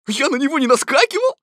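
high, loud, rapid denial; fast, high-pitched tiger protest. A narrator's reading. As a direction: blurt out an indignant denial. Accused tiger denies pouncing.

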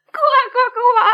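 high, loud croaking laugh. Acted. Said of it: "засмеялась лягушка".

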